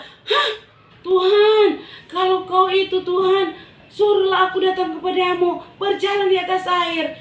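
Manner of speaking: high, loud eager call; Peter calling out boldly. Acted. Peter calling to Jesus from the boat, eager. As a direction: call out across the water. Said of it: "Petrus itu bilang".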